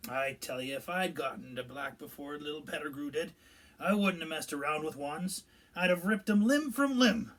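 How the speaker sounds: rising to a loud, angry roar; growling. A narrator's reading. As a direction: build to a furious threat. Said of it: "Hagrid growled".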